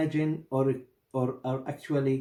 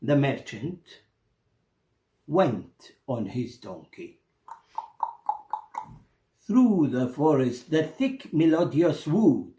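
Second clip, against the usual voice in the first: lightly voiced storybook narration; a storyteller opening the tale for his audience, gently; story narration; 2 semitones higher than usual. A narrator's reading. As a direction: tell the tale to listeners, unhurried. Narration resumes.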